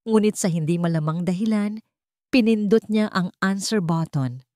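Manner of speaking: ordinary delivery, nothing marked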